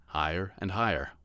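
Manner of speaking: ordinary delivery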